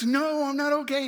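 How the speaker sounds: high-pitched imitation of a distraught man; frantic retort. Injured man answers the worried driver, imitated frantic retort. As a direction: answer high and agitated, as the stranger. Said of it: "And he goes".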